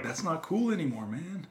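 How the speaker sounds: high-pitched imitated complaint, softening at the end; mock protest. Wrestler tells Hogan to drop the brother thing, high protest. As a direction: lift the voice well up as if pleading. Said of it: "And I'm like".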